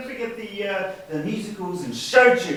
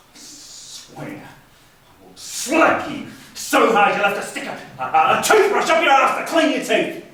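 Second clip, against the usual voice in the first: higher and louder, building; hammed-up threat; 7 semitones higher, 5 dB louder than usual. Brother threatening to slap Michael, a loud hammed-up threat. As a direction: spit out the over-the-top threat.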